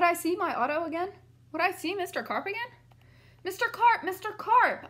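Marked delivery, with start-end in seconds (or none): 3.4-4.9 high, louder anxious call; calling out a name, worried; yelling. Acted. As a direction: call down into the pool. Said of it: "I yelled".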